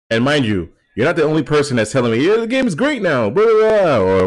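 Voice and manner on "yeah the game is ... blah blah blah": mocking. Mocking others' praise.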